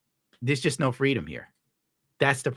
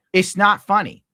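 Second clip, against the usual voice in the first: higher and louder, real exasperation; worked up; 9 semitones higher, 8 dB louder than usual. Real, not acted. Streamer venting to chat about potholes, higher and louder. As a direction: blurt it out, fed up. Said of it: "I'm, like, getting worked up".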